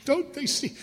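high, self-pitying mock whine; whiny addict. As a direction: send up the poor-me addict. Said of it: "that addict alcoholic".

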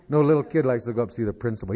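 usual speaking voice

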